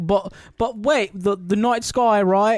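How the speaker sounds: put-on a light British accent, fussy and eager. Steven to Layla about the night sky, light British accent. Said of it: "Steven takes over and he's just like".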